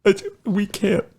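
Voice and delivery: high, put-on woeful voice; whining divorced boss. Crime boss declining over his messy divorce, mock-pitiful whine. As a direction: plead feebly, high and hammed-up.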